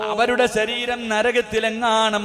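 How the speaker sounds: loud, chanting sermon cry with long-drawn endings; impassioned preaching. Acted. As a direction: preach at full force, stretching the last word of each phrase. Preaches at full force. (ഉച്ചത്തിൽ ഈണത്തിലുള്ള വികാരഭരിത പ്രസംഗം)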